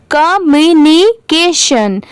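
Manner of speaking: "Communication" stretched into ka-myu-ni-ke-shan; slow syllable by syllable. Shown as a demonstration. Teacher leading a repeat of a big word, "communication" very slowly by syllable. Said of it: "मेरे बाद repeat करिए".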